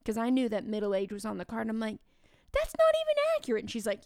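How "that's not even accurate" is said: very high, fast squeak of outrage; indignant protest. Retold or imitated. Teacher objecting to the clue about her age, squeaky and indignant. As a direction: squeal it in mock offense.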